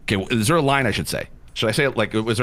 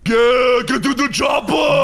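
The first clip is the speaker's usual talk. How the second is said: higher, loud Arnold-style bellow; action hero shout; 11 semitones higher, 4 dB louder, slower than usual. Acted. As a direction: yell it like a movie strongman. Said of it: "I can do that too".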